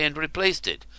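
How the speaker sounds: usual speaking voice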